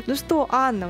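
ordinary delivery

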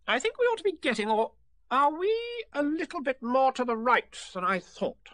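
high-pitched Rabbit, doubting himself; fussy, unsure Rabbit. A narrator's reading. Rabbit lost in mist, fussing over directions. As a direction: think aloud in a high character voice.

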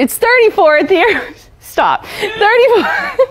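straining to hold it together; laughter bursting through; trying not to laugh. Real, not acted. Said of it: "I'm cracking myself up".

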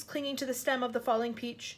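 ordinary delivery, nothing marked

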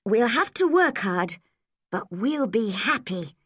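put-on eager young Anne. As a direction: plead with Marilla as a determined young girl. Anne's happy closing speech.

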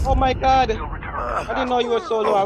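high, loud alarmed exclaim; startled and loud; surprised. Real, not acted. Alarmed at teammate's health.

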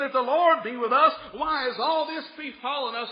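loud, high protesting question; Gideon's complaint. Acted. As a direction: demand to know why. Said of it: "he said".

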